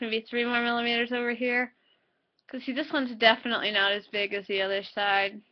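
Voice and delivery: lips stretched by finger; talking through tense grin; finger pulling mouth open. Real, not acted. Seen 2: Finger hooks mouth corner showing teeth; paused briefly around 4.5-5.3s.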